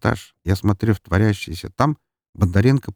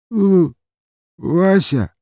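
high, hiccupy blurt of a name; drunken short answer; 11 semitones higher, 5 dB louder, much slower than usual. A narrator's reading.

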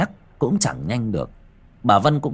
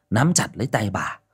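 ordinary delivery

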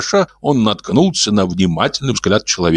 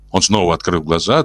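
plain reading voice

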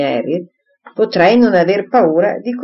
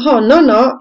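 high, louder, a laughing protest; laughing exclamation; 6 semitones higher, 5 dB louder than usual. A narrator's reading. Emma laughing off Frank's question, high exclamation. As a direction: toss it off with careless laughter. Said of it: "esclamò Emma ridendo".

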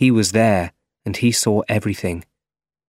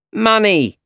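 high-pitched call for payment; shouted demand; 11 semitones higher, 4 dB louder than usual. A narrator's reading. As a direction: landlord shouting out a demand, moderately loud. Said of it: "shouted Mister Ditkovich".

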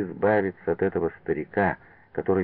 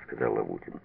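plain reading voice

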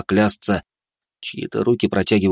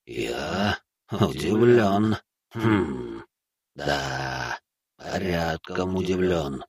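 slow, soft, echoing presence; a vast being faintly amused; ancient entity voice; 5 dB quieter, much slower than usual. A narrator's reading. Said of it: "раздался голос. Отовсюду".

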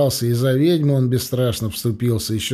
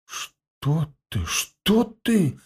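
a mild frightened protest, rising high; 4 semitones higher, 4 dB quieter, much slower than usual. A narrator's reading. Ebenezer recoiling from raising a hand against a priest, frightened. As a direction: wave it off in alarm. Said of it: "испуганно замахал руками Эбенезер".

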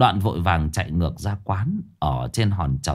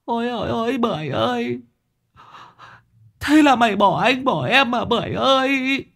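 hammed-up wailing. Sáu Bưởi wailing over Bảy Lươn's limp body, high keening. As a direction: wail over the body like a professional mourner. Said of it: "Sáu Bưởi khóc lóc".

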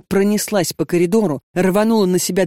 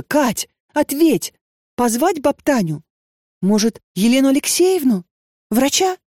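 higher, anxious rushed questions; alarmed worry; 8 semitones higher than usual. A narrator's reading. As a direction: panic over a crying friend. Lenochka pleading, alarmed.

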